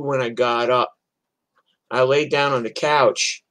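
normal talking voice

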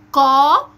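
قا loud, long and heavy with an open A; qaf with fatha. Shown as a demonstration. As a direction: model a forceful, sustained قا. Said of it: "بننطق الصوت بالفتح وبالكسر وبالضم". (قاف مفتوحة بقوة)

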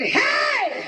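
sharp high call across the room; yelled hey. Retold or imitated. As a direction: shout it out to the departing prisoner. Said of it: "he yelled out to him".

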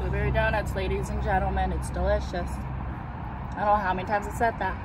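talking through hand while eating; muffled by hand and food; mouth full, chewing. Real, not acted.

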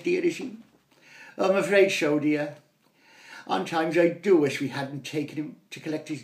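mock upper-class husband and wife at the table; posh parents chatting. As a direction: play the snooty parents over breakfast. Voices posh parents in dialogue.

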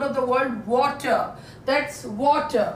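water said slowly, r dropped; slow model pronunciation. Shown as a demonstration. Teacher models water slowly for students. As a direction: stretch out water with no r. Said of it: "इसको ये ऐसे लिखते हैं".

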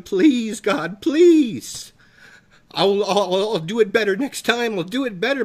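high, loud begging cry; desperate pleading. Acted. Souls in hell begging God for another chance, desperate. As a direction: plead as if it is too late.

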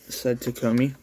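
ordinary delivery, nothing marked

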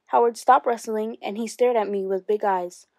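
ordinary delivery

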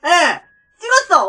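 loud, high-pitched disbelief; astonished outburst. Real, not acted. Player whose wild guess was right, astonished exclamation. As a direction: exclaim as if you cannot believe your luck.

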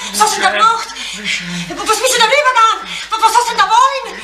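high, agitated questioning; horrified reproach. Acted. Asking Erich what he has done, horrified reproach. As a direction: demand answers in rising panic.

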